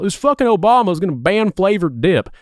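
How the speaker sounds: mock the viral Southern Indiana rant in a high twangy voice; Southern Indiana accent. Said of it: "you sound like that guy from the famous".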